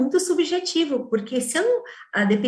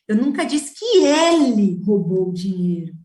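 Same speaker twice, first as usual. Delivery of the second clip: "que" pushed up; stress on QUE; 6 dB louder than usual. Shown as a demonstration. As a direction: hit "que ele roubou", the rest plain. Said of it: "se eu mudar a ênfase ao ler essa frase".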